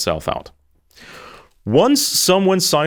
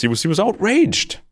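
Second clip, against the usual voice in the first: mock outrage; 11 semitones higher than usual. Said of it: "He was acting, uh, like this".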